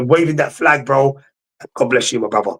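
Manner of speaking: ordinary talk, nothing marked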